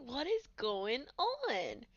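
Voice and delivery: high, whiny put-on voice for the sick moment; mock sick voice. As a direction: squeak it out like you're suddenly unwell. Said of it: "and I'm like".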